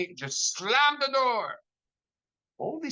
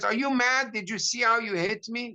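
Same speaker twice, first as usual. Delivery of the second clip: indignant shout; 7 semitones higher than usual. Retold or imitated. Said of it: "And he screams".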